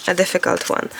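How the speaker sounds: ordinary talk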